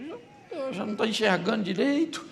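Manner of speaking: high, far-off mock-sad church member; grieving church member. A church member telling the pastor the dog died, high and mock-whiny. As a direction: squeak it out like a whiny believer. Dog owner voiced again.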